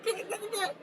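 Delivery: high, breaking into laughter; laughing quote. Retold or imitated. Retelling the heckle while laughing, high. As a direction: squeak it through laughter. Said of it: "everyone hears it".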